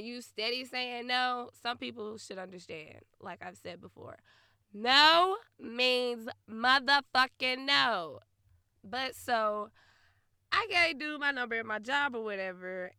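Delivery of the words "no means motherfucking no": slow, loud, weighty NO at both ends; drawn-out, forceful declaration; emphatic. Real, not acted. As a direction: spell it out slowly and loudly, hitting each NO. Stating refusal emphatically to listeners.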